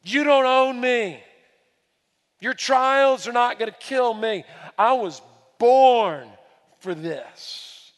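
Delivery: I was born for this, proclaim it slow and firm; voicing Jesus to Pilate. Jesus answering Pilate, slow defiant declaration. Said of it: "Jesus looks at Pilate and says".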